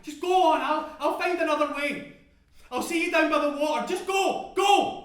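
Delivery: high, loud, insistent plea; crying out. Acted. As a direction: drive them off, building to a shout. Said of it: "I cry".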